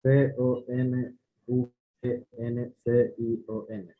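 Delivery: letter by letter, evenly spaced; spelling it out. Shown as a demonstration. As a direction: spell each letter out separately.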